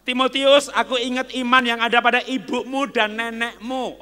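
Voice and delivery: loud, high apostle calling out; Paul calling out to Timothy; Paul's voice. Acted. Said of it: "Paulus bilang sama Timotius".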